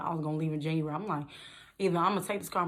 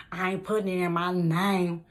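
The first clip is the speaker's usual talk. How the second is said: usual speaking voice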